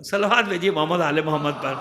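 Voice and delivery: loud call to the congregation; calling out for salawat. Real, not acted. Preacher calls the gathering to send salawat, loud call. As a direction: raise the call for blessings.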